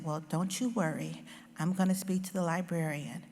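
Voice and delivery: quiet, gentle comfort; soft reassuring mother. Retold or imitated. Mother reassuring the poor girl about the library, soft and gentle. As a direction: soothe the child softly. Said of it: "And my mother said".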